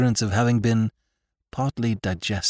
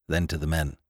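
ordinary delivery, nothing marked

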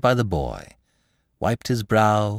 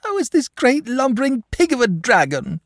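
put-on high, loud tearful child; sobbing boy; 5 dB louder than usual. A narrator's reading. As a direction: wail the complaint in a small boy's voice. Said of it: "sobbed the boy".

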